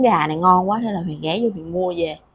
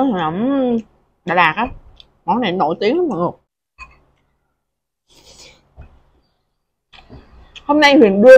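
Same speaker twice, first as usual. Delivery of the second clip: speaking between bites; talking while chewing; 2 semitones higher, 6 dB louder than usual. Real, not acted. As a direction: mouth partly full of food. Seen 2: lips pressed, cheeks fuller between words; food held at mouth.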